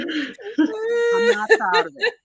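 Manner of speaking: high, slow, hammed-up sob-voice; fake-cry the confession, slowly and high; mock crying. Confessing she licked the peanut brittle bag, in a hammed-up mock cry.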